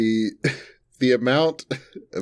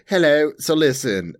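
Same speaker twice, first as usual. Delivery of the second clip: higher, louder put-on British accent; mock British accent; 8 semitones higher, 4 dB louder than usual. Teasing the British co-host by playing them, mock British accent. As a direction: imitate your co-host's British accent. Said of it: "I heard that accent there".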